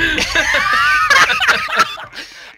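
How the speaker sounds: high, shrieking laugh; real high-pitched laughing fit; helpless laughter. Real, not acted. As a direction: laugh till it squeals. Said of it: "I'm fucking dying".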